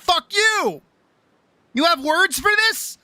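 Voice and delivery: high, exasperated "Fuck you!"; imitating his own exasperated outburst; mock outburst. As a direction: throw the retort out high, in disbelief. Retorting to the theming excuse.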